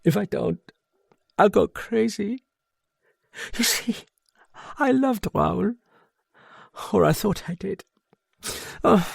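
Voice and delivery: high, strained near-tearful woman; mildly tearful confession. A narrator's reading. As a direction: hold back tears as it spills out. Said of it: "her face growing half ugly with tears".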